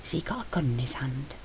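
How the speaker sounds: soft, tense, under the breath; a nervous aside in the hole; hissed question. A narrator's reading. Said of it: "Sentry hissed".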